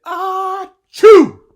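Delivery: huge high loud sneeze; big sneeze. Acted. The flea sneezing on the sleeping barn, huge and loud. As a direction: blast out a giant achoo. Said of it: "he said".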